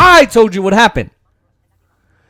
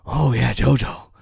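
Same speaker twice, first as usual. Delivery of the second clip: lower, softer put-on old man; mock president voice; 8 semitones lower, 7 dB quieter than usual. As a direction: croon the nickname like a chummy old politician. Mocking president using nickname.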